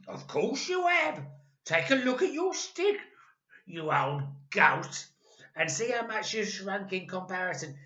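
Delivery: put-on loud, high jeering old man; taunting Mister Twit. A narrator's reading. Husband taunting wife about shrinking, loud jeer. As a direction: rub it in mercilessly.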